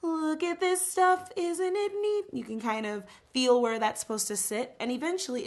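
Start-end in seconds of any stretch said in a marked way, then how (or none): 0.0-2.3 high line started in the nose; nasal placement. Shown as a demonstration. Teacher shows students starting placement at the nose. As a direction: buzz it through the nose first. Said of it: "start maybe with the nose".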